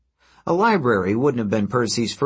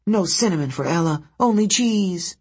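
angry stutter; 8 semitones higher than usual. A narrator's reading. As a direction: trip on the first sound, sulky and cross. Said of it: "she stuttered angrily".

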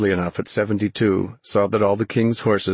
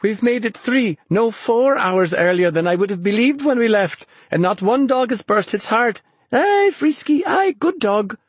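put-on high, exultant young-heroine voice; thrilled, high-pitched praise for the dog; excited cry; 14 semitones higher, 3 dB louder than usual. A narrator's reading. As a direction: gush with pride, pitched way up. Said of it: "she cried".

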